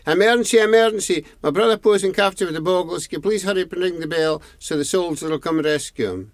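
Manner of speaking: fast, high urgent plea; breathless, rushing to warn. A narrator's reading. Boy urgently wakes old man.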